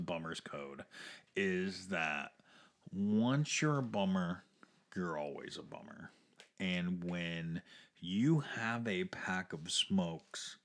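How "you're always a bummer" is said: mock ominous whisper. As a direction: whisper the rule darkly. Dramatic rule, hushed.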